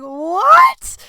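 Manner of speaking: high, loud, drawn-out disbelief; retold shocked what. Cat owner reacts to groomer's heart attack warning, shocked. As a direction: exclaim it in exaggerated alarm.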